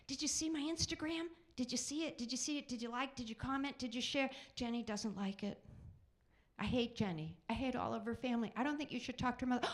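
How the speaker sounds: put-on high, rapid-fire teen girl pestering; teenage girl. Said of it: "this is my impression of a teenage girl".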